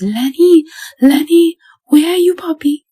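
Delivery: high, loud frantic screams; screaming. A narrator's reading. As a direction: scream for the lost puppy. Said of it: "she screamed".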